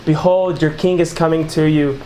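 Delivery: ordinary talk, nothing marked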